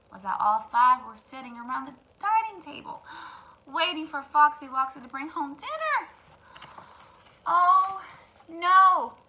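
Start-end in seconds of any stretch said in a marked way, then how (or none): 3.1-3.6 whispered gasp; a sharp breath in. Real, not acted. Narrator reacts. 7.4-9.1 loud, higher dismay; alarmed oh no. Real, not acted. Narrator alarmed.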